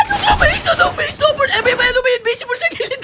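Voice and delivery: high-pitched, tripping over words in alarm; panicked stammering. Real, not acted. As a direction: stutter out a frantic warning. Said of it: "nabubulol-bulol pa!". (natatarantang pautal-utal, mataas ang boses)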